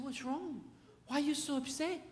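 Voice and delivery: high, soft coaxing voice; tender fatherly questions, acted out; concerned parent. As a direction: gently coax an upset little girl, quiet and lifted. Dad gently asks upset daughter.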